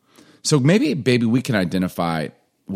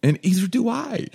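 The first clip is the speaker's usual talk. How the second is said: dad's retort; 13 semitones higher than usual. Retold or imitated. Dad answering the son.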